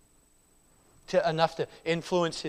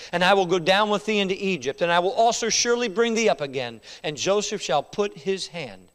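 louder, rising voice of God; proclaimed scripture; 2 semitones higher, 6 dB louder than usual. Acted. Preacher voicing God's promise to Jacob, rising and loud. God's words read aloud building.

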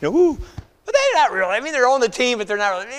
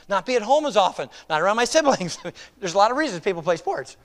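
usual speaking voice

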